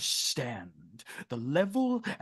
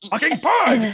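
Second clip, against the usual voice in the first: high, loud outburst at the bird; furious shout; 12 semitones higher, 14 dB louder, slower than usual. Acted. Lord yells at the crow on the windowsill, furious. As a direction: yell at the pest.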